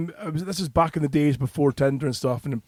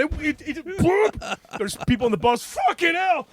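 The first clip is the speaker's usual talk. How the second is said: excited high retelling; 11 semitones higher than usual. Retold or imitated.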